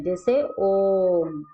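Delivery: ओम held very long, slow; prolonged om. Shown as a demonstration. Teacher showing prolonged vowel in ओम. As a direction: draw ओम out three times long. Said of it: "तीन गुना समय लगता है".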